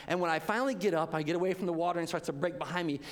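usual speaking voice

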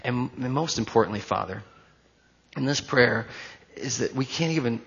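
soft, earnest prayer voice. Acted. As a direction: pray over the room, hushed and sincere. Leads the congregation in prayer.

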